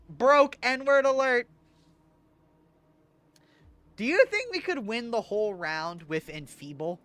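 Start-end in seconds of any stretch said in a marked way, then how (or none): 0.1-1.5 loud, high, slow mock siren call; mock alert. Calling out his own lack of money, mock alert. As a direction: sound the alarm for a joke.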